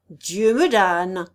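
loud, slow, drawn-out exclamation; astonished oath. A narrator's reading. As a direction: swear slowly in astonishment. Lord astonished, recognizes Thibault. (juron stupéfait, fort et lent)

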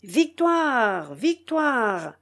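drawn-out, restrained cheer; cries of victory. A narrator's reading. Mutineers crying victory below deck, restrained cheer. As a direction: echo a distant crowd's triumphant cry. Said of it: "lorsque des cris de".

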